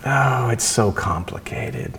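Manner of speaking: slow, shrugging dismissal; slow mock shrug, waving it all away; dismissive sigh. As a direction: brush it off slowly. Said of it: "And I remember saying".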